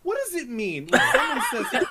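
high, loud, baffled outburst; mock exasperated demand. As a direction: demand an answer, breaking into laughter. Acts out baffled interrupting question.

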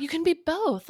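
high, mocking imitation; mocking quote. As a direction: parrot it back in a high mock voice. Mocking the quoted husband's line.

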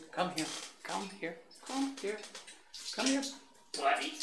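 high, soft little calls; dog coaxing. Real, not acted. Host lures the dog within reach, soft high coaxing.

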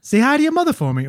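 hammed-up Boston catchphrase. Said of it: "that's all I got".

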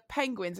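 penguins said PING-wins; mispronounced penguins. Retold or imitated. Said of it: "he calls them penguins".

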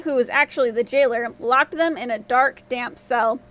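ordinary delivery

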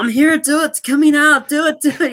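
high, loud mock action-hero urging; quoting movie line. Podcaster quoting the movie for a co-host, hammed-up high yell. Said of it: "He's like, quoting".

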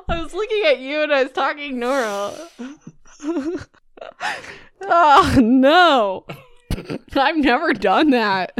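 helpless, high-pitched laughing fit; laughing to tears. Real, not acted. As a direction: talk through real crying laughter. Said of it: "I'm crying".

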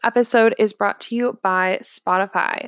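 upbeat sponsor read. Acted. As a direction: sell it like a radio ad.

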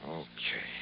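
barely voiced, giving in; whispered okay. [name] quietly gives in to Ginny, whispered. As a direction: breathe it out, relenting.

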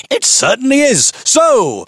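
high-pitched, over-eager agreement; piles of enthusiasm. Acted. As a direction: gush it like an overkeen stranger. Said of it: "with piles of enthusiasm".

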